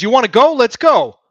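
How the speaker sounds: loud, high, riled-up taunt; fight challenge. Acted. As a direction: square up and yell the dare. Voiced player challenging to fight.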